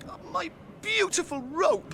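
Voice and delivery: high, slow wail over the rope; lament. Acted. Sam mourns his rope to Frodo, high slow wail. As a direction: mourn it like a lost friend.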